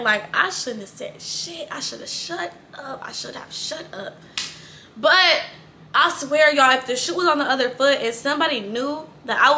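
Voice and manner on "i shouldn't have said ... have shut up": fading from soft murmur to a whisper; regretful self-talk dropping to a whisper; inner thought. Retold or imitated. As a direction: mutter it to yourself full of regret. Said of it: "I'm thinking in my head".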